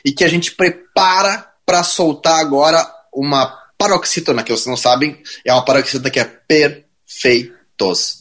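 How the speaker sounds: slow, teasing drawn-out delivery; slow buildup. Acted. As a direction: stretch it out for suspense.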